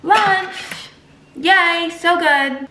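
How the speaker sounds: bright high announcement; excited cheer. Real, not acted. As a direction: cheer as the food arrives. Cheering as lunch arrives.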